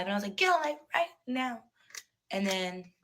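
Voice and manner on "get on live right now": higher-pitched, pressing command; retold urgent order. Retelling the call to go live, urgent and higher. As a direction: urge them on, pitch jumping up.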